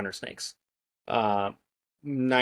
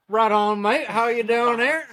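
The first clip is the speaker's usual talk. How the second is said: Southern US drawl mixed with Aussie, higher and louder; a jokey Southern-Australian mash-up greeting; 12 semitones higher, 7 dB louder than usual. Pretend. Guest greets the host in a mock Southern-Aussie accent. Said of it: "that's a Southern accent with Australian".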